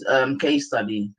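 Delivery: usual speaking voice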